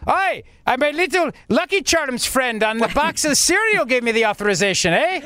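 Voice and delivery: hammed-up mock Irish accent; Andrew Cuomo. Host parodying the governor dodging a serious question, mock Irish accent. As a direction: play a cereal-box leprechaun, stage Irish. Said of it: "And here's Cuomo".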